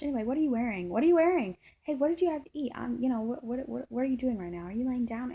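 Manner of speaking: imitating her pestering questions, high and loud, then easing; mock a scatterbrained change of subject; erratic. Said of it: "getting erratic again".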